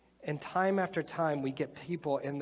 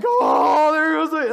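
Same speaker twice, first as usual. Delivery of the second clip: much higher, loud mock panic shout; panicked parent's yell; 12 semitones higher, 16 dB louder, much slower than usual. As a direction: holler like a parent watching the trap snap. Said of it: "they're just freaking out".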